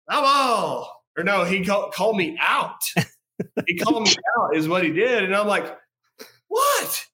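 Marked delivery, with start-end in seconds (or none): 0.1-1.0 lightly mimicked umpire calling it, higher; umpire's call. Storyteller mimics the ump's call at first base, umpire's call. 6.5-7.1 very high-pitched mock disbelief; incredulous. Batter reacting to being called out, incredulous.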